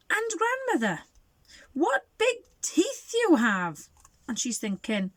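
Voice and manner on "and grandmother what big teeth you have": put-on high, slow wondering girl; little girl voice. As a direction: wonder aloud like a small child.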